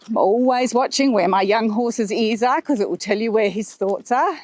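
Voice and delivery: ordinary delivery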